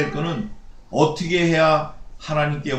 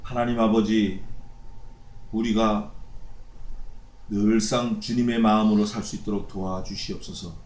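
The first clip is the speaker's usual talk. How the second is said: closing prayer; 5 semitones lower than usual. Acted. Pastor leads closing prayer.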